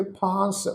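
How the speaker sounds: ਫਾਂਸ with a long nasal aa; nasalised long vowel. Shown as a demonstration. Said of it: "ਫੱਫੇ ਨੂੰ ਕੰਨਾਂ ਉੱਤੇ ਬਿੰਦੀ".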